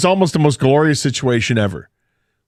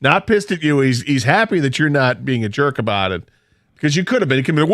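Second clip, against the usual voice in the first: ordinary delivery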